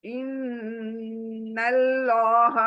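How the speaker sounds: slow इन्नल्लाह with a full, round लाम; heavy Allah. Shown as a demonstration. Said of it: "आप भी कोशिश करें पढ़ने की साथ में".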